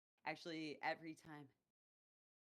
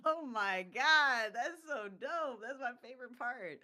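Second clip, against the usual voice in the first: excited; 9 semitones higher, 13 dB louder than usual. Retold or imitated. Excited reaction retold.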